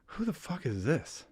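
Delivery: high, soft mock disbelief; mock baffled wife. Wife sees the wrong man's photo, mock bafflement. Voicing the wife's baffled reaction.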